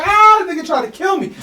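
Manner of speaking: high, loud panicked scream; panicked yell. Retold or imitated. Bitten salesman screaming in panic at the dog attack. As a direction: yell it like you think you're dying. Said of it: "I'm going crazy".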